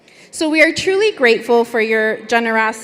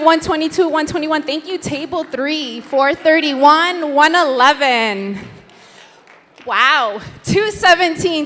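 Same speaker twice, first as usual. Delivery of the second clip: normal talking voice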